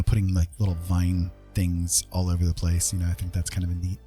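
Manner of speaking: ordinary delivery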